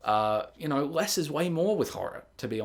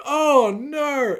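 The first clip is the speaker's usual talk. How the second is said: mock horror; 12 semitones higher, 6 dB louder than usual. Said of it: "And it's like".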